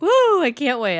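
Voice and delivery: loud, high cheer; excited cheer. Real, not acted. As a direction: whoop with excitement.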